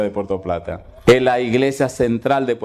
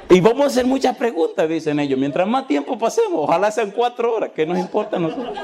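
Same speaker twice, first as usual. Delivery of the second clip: gleeful employees; 8 semitones higher than usual. Retold or imitated. Employees plan to stall seminar.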